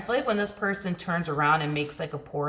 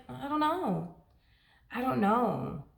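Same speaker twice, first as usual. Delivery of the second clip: high, softer put-on cluelessness; mock helpless shrug; 7 semitones higher, 3 dB quieter, slower than usual. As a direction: feign having no idea, lightly. Acts out indifferent refusal to help.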